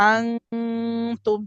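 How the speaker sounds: আনতুম with a very long nasal ng; slow, held nasal আনতুম; ghunnah. Shown as a demonstration. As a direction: hum on the n of আনতুম before landing on tum. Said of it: "গুণনাও।".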